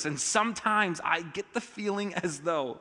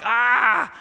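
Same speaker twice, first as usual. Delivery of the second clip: tense groan; 7 semitones higher, 9 dB louder than usual. Retold or imitated. Tension when spouse arrives.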